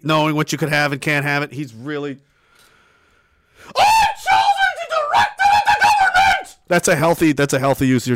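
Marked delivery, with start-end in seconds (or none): 3.8-6.6 high, far-off ranting yell; ranting shout. Pretend. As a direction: scream it like a crazed rant. Voicing Billy ranting.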